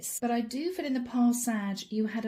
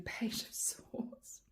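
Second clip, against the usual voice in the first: whisper; 12 dB quieter, much slower than usual. Real, not acted. Whispered card name, voice catching.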